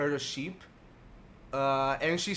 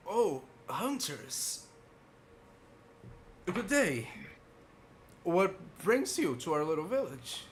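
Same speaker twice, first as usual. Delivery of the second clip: put-on very old man; 4 semitones higher than usual. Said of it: "so, like, a very frail old man".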